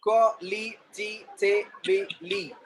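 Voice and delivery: "Qualitatively" very slowly split into separate syllables. Shown as a demonstration. Teacher showing the class how "Qualitatively" divides, syllable by syllable. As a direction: say "Qualitatively" in slow, distinct beats. Word in syllables, then whole.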